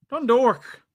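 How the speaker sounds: loud, high call of Dundalk; announcer shout; announcing. Acted. Calling out team name.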